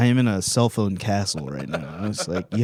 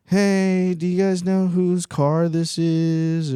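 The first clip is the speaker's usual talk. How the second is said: timid polite asking; 8 semitones higher, 3 dB louder, much slower than usual. Retold or imitated. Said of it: "and I go".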